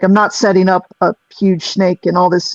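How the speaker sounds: normal talking voice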